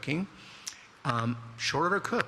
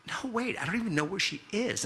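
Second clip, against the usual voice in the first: high, hurried mock protest; panicked denial; 10 semitones higher than usual. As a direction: plead innocence fast, voice jumping up. Pleading with threatening parents, retold.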